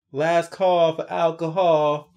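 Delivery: slow sung-out bartender call; hammed-up last-call announcement; mock last call. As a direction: draw it out like closing time at a bar. Mock announcer line about drinking.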